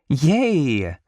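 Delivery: high, drawn-out woman's cheer; praising cheer. A narrator's reading. Georgia praising her nephew, cheering. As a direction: cheer for a small child. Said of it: "Georgia praises Ace".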